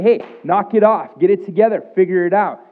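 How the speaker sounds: loud, high scolding; Jesus not saying a stern rebuke; mock rebuke. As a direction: bark at them to pull together. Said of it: "He doesn't say".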